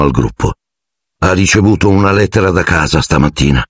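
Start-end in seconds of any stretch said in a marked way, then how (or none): none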